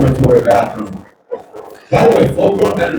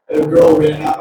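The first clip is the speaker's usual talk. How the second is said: ordinary delivery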